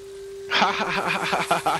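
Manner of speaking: high gleeful laugh. Real, not acted. Player watching the camp burn, laughing gleefully. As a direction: laugh with delight at the fire. Laughing after lighting the fire.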